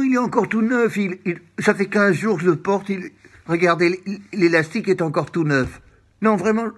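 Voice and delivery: high, loud, flustered objection; indignant protest. Acted. Wearer insisting the elastic is still new, flustered protest.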